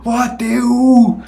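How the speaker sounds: long high call into the phone; drawn-out call. Retold or imitated. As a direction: call out to a lost friend. Drunk man calling friend on phone.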